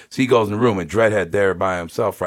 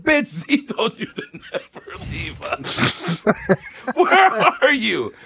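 laughing; 14 semitones higher, much slower than usual. Retold or imitated. Yelling at driver who left.

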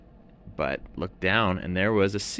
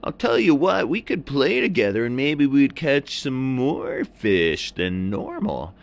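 high, loud surfer voice; an eager surfer bro; surfer seal; 9 semitones higher, 5 dB louder than usual. A narrator's reading. Seal offering to team up, loud high surfer voice. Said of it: "And the seal said".

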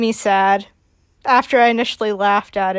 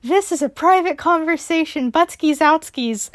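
put-on higher, bratty little boy; a cheeky kid shooing grown-ups away; pitched-up child brushing off adults; kid Spencer voice; 6 semitones higher than usual. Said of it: "he's like".

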